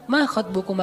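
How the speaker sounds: soft conversational question, no preaching; gentle, polite. Shown as a demonstration. Musa politely addressing two young women, gently. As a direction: ask it kindly and casually. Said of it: "Kan lagi ngomong sama cewek nih".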